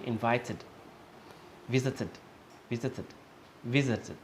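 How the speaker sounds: "Invited" and "visited" ending in an "id" syllable; careful model "visited" as "viz-it-id". Shown as a demonstration. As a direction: drill "Invited", "visited" word by word. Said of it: "sounds like an I D instead of an E D".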